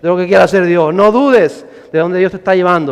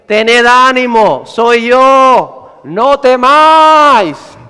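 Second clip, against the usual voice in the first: loud, slow, stretched proclamation; commanding call, high and sustained; Jesus calling out; 5 semitones higher, 5 dB louder, much slower than usual. Acted. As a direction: call it across the water, raised and drawn out.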